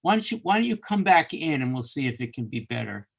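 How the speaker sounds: normal talking voice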